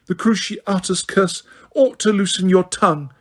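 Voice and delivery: ordinary talk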